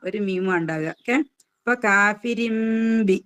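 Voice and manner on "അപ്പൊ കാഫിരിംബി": slow recitation of കാഫിരിംബി, nun turned to m; recited with iqlab. Shown as a demonstration. Teacher showing the nun turned to meem, slow recitation. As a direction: chant കാഫിരിംബി slowly with a clear m before b. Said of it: "നൂനിന്റെ sound എവിടെയും ഉണ്ടാവൂല".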